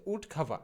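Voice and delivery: উড খাভা with aspirated kh and no r; corrected pronunciation. Shown as a demonstration. Teacher correcting woodcarver, উড খাভা ending in open "va". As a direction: say উড খাভা as "wood KHA-va". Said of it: "বলবো হচ্ছে".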